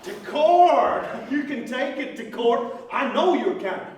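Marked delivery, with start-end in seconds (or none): 0.0-1.0 scornful shout. Acted. Scornful retort in the quarrel.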